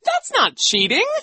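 very high, loud woman teacher; indignant contradiction; protesting. A narrator's reading.

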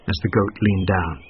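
ordinary delivery

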